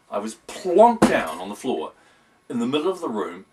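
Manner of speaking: ordinary delivery, nothing marked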